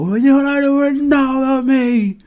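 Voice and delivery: high, slow, mock-mysterious sing-song; coy teasing. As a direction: tease them like a diva hiding a secret. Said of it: "And just flip out".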